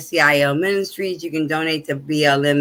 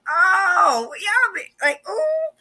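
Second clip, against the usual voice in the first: high, thrilled squeal; imitating her excited younger self; 12 semitones higher, much slower than usual. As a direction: gush like an awestruck teen. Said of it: "Like, I was excited".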